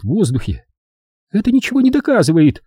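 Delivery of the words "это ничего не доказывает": high, quick, dismissive falsetto; fast high-pitched scorn; scornful snort. A narrator's reading. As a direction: scoff at it in a high woman's voice. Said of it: "фыркнула она".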